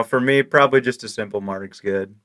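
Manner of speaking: usual speaking voice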